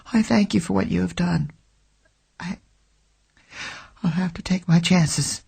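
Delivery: frightened woman bravely says goodbye; trembling but composed. Acted. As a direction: hold back tears with dignity. Trembling woman thanks him.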